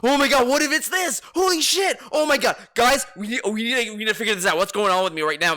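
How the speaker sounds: mocking someone panicking over a paper cut; mock freaking out. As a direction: play a guy losing it over nothing. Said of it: "And then you start freaking out".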